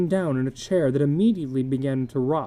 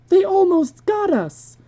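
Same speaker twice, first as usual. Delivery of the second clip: panicked; 7 dB louder than usual. Acted. Wife panicked after attack.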